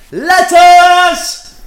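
very high, loud drawn-out yell; segment-title shout. Pretend. Host announcing the letters segment, high exuberant shout. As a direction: belt out the title, stretching it.

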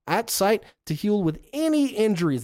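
news announcer. Acted. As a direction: sell it like live TV coverage.

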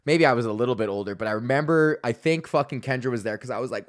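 ordinary talk, nothing marked

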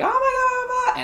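high, loud mock panic; freaking-out girlfriend. Girlfriend panicking after the car crash, high squeal. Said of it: "she's freaking out".

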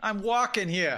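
slow, loud, high New York shout; New York accent. Retold or imitated. Pedestrian in New York, loud hammy accent. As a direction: yell it like a hammy New Yorker. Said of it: "I was in New York".

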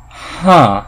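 a loud, thoughtful mock "huh"; huh reaction. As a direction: voice the puzzled wonder of a reader. Acting out reaction to reading.

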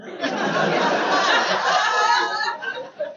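high giggle. Real, not acted. Laughing at own joke.

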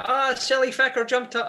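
high-pitched mock Irish accent. Bandmate retelling the singer's reply about the twisted ankle, mock Irish accent. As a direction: put on an Irish voice, pitched right up. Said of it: "that's my Irish accent".

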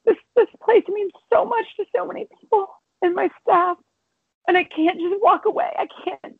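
pushing the words out through real tears; close to tears. Real, not acted. Telling her mother she can't leave, voice breaking with tears. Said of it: "Don't make me cry".

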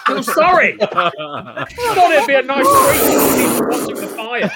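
high, laughing apology, slowly; real laughter breaking the scene. Real, not acted. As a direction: giggle through the excuse.

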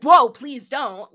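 loud, slow, alarmed "whoa"; startled protest. Retold or imitated. Marla pulled in by Khan, a loud alarmed protest. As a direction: recoil and push him off.

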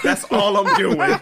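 laughing through the line. Real, not acted. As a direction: crack up as you say it. Repeated punchline, laughing.

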